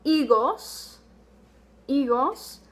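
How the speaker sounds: higos with no H sound, EE-gos; silent H. Shown as a demonstration. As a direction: drop the H in higos. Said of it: "our H is silent".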